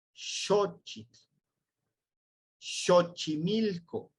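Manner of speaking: "Xochitl" and "Xochimilco" slowly, each starting with sh; sh pronunciation. Shown as a demonstration. Teacher modelling the sh sound for the class. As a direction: draw out the sh in "Xochimilco". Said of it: "suena como es el de sh".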